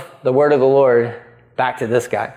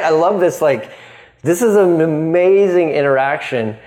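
ordinary talk

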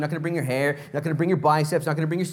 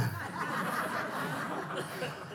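airless helpless giggle; real laugh at his own story; voiceless laugh; 11 semitones higher, 11 dB quieter than usual. Real, not acted. Preacher cracking up at the story, silent giggle.

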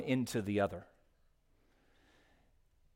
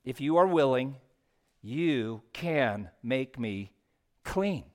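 slow pleading; 2 semitones lower than usual. Acted. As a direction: plead it slowly as a desperate leper. Slow pleading reread of the plea.

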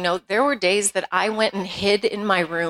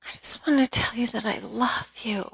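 soft whispered confession; 2 semitones higher, 4 dB quieter than usual. Retold or imitated. Son whispering to his mom that he loves her. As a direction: whisper it gently, almost unvoiced. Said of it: "And he goes".

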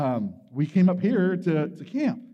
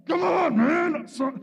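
a frantic high yell at his own frozen hand; screaming at himself; 9 semitones higher than usual. Retold or imitated. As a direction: shout yourself into courage. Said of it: "I'm screaming at myself".

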